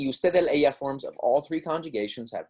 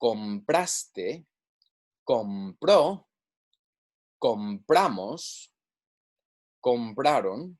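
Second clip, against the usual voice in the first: measured model pronunciation, pauses between words; repeat-after-me drill; much slower than usual. Shown as a demonstration. Spanish teacher modelling verb forms for students to repeat, deliberate drill. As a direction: say each form carefully for learners to echo. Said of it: "Go ahead and repeat after me".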